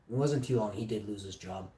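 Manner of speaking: normal talking voice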